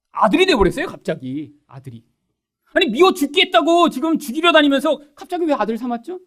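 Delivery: ordinary talk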